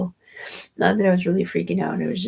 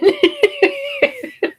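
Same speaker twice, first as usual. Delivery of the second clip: squealing real laugh, much higher; 6 dB louder than usual. Real, not acted. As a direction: burst out laughing. Laughs at own story.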